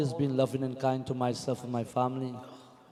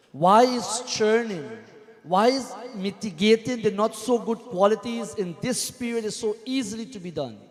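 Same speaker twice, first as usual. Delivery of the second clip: fervent preaching; 5 semitones higher than usual. Acted. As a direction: preach it out, building with each why. Preaching at full force.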